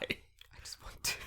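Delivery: slow, soft whisper; small whispered reply; hushed admission. Real, not acted. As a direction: whisper it sheepishly, drawn out. Small reply after being questioned.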